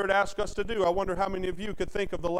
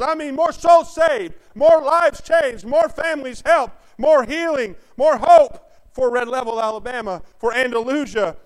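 full-force preaching; 4 semitones higher, 7 dB louder than usual. Acted. Preacher warning his congregation, preaching at full force. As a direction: preach it out to the back pew.

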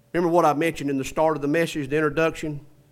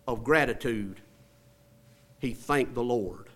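ordinary talk, nothing marked

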